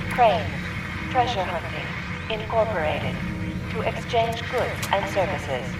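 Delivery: high, processed announcer voice over music; robot broadcast voice. Acted. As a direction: read it like a machine PA broadcast.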